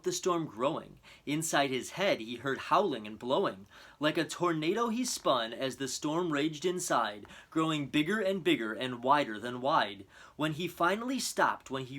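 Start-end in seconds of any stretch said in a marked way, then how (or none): none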